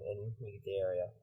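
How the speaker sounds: usual speaking voice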